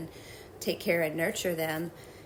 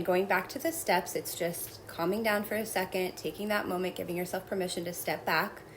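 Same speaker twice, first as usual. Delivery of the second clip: ordinary talk, nothing marked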